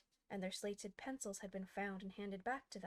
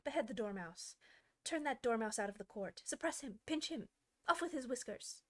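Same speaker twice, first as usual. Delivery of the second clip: furious orders; 3 semitones higher, 3 dB louder than usual. Acted. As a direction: bark each order angrily. Queen keeps shrieking orders.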